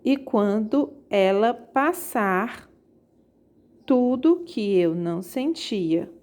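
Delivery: slow, scanned line, each syllable spaced out. Shown as a demonstration. Teacher demonstrating poetic scansion to students, slow and spaced. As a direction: stretch the verse to count its syllables. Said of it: "Veja o exemplo, ó".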